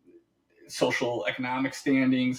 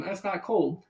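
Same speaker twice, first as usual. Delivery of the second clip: mock shrug; 5 semitones higher than usual.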